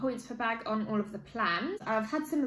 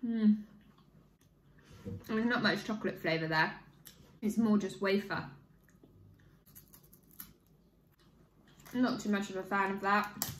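speaking between bites; chewing while talking; 2 semitones lower than usual. Real, not acted. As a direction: mouth partly full of wafer. Seen 4: Bites wafer at -3 and 7, lips closed chewing between.

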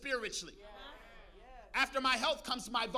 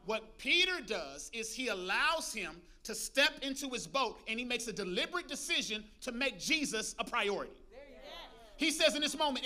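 ordinary delivery

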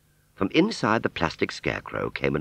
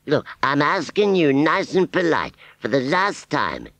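high, slow, final warning; slow deliberate snap; 11 semitones higher, 3 dB louder than usual. Acted. Wurzel losing patience with silent scarecrow, slow deliberate snap. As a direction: spell out the last polite ask. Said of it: "he snapped".

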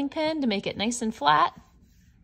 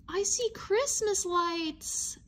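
surprised; 8 semitones higher, 3 dB quieter, slower than usual. Acted. Reveal in red box.

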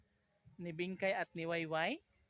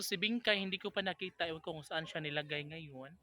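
ordinary talk, nothing marked

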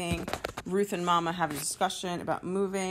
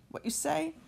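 much higher challenging question; angry Mama; 12 semitones higher than usual. Acted. Angry Mama challenging her daughter about her brother, pitched high. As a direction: challenge it, pitch swooping up at the end. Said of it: "Mama's gonna get angry".

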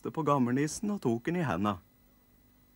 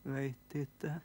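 shy whisper; 4 semitones higher, 7 dB quieter than usual. Acted. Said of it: "kvisker vesselgutten".

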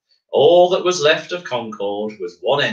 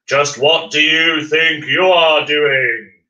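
louder, slow booming demand; 4 semitones higher, 5 dB louder, slower than usual. Acted. A voice above challenges Miles on the screw, slow and loud. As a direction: boom it down from above. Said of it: "said a loud voice above him".

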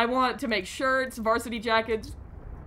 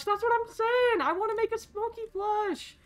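very high-pitched enthusiasm; excited squeal; 12 semitones higher than usual. Real, not acted. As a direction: squeal it with eager delight. Excited about plush merch.

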